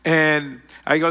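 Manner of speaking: usual speaking voice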